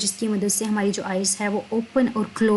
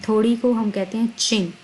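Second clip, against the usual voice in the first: ordinary talk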